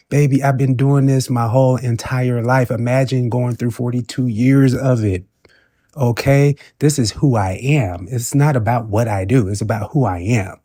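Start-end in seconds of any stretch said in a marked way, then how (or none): none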